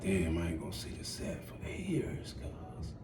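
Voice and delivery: whispered, barely audible; hushed whisper in the courtroom; under his breath. Retold or imitated. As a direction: whisper it to yourself. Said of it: "under my breath out loud".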